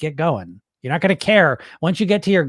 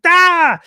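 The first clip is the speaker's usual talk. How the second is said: a sudden high frightened "Ah!"; loud panicked yelp; mock panic yelp; 10 dB louder than usual. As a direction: yelp like you just slipped up. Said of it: "it's just like".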